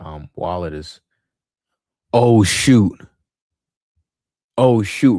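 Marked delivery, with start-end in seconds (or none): 2.1-5.2 loud startled oh shoot; sudden loud surprise; surprised exclamation. Real, not acted. Sudden reaction to the screen.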